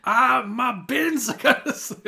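high, slow, loud Scottish accent with a laugh. Shown as a demonstration. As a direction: have another go at Scottish. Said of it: "let me try it again".

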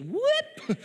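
drawn-out imitated whoop of a fall; slipping whoop. Storyteller mimicking the counselor slipping, comic whoop. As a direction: sound out a sudden slip.

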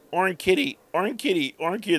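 put-on high, repeated toddler call; little girl. Owner imitating little girl spotting his cat, childlike call. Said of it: "there was a little girl here".

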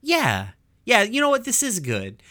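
lightly acted agreement, starting high; Lapis. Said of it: "And Lapis is like".

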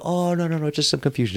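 high, innocent backpedalling; smooth it over for the cop; placating excuse. Retold or imitated. Drunk thief explaining himself to a cop, placating.

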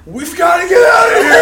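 high panicked yell, full blast; acted-out yell of pure terror; terrified shout. Big guy fleeing a prank in the dark, terrified shout. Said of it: "pure, unadulterated terror".

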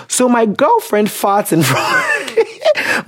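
real laugh cuts off the sentence; breaking into laughter. Real, not acted. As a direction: start reading high and slow, then crack up. Cracks up reading the letter.

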